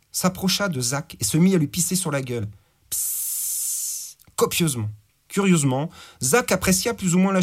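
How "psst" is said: unvoiced hiss of a stream; hissing pee sound. A narrator's reading. Stream of urine.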